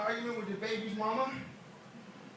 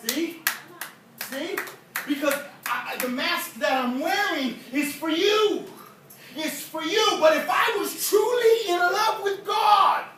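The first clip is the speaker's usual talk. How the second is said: high, loud, climactic sermon delivery; full-force preaching; 9 semitones higher, 9 dB louder than usual. Real, not acted. Preacher vowing to show his son the real him, full-force preaching. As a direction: cry it out to the congregation, driving every phrase.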